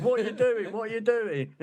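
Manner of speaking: put-on high-pitched send-up of an Oxford accent; Martin Keown. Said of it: "in his, uh, embarrassing Oxford accent".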